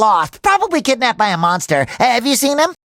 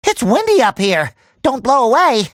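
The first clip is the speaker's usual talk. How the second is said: ordinary delivery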